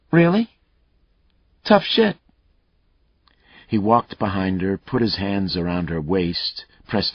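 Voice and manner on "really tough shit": loud, high, sneering retort; harsh taunt. Acted. Captor sneering at his hostage, loud and high. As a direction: throw it back at her hard.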